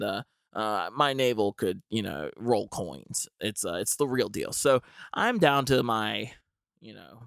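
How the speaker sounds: usual speaking voice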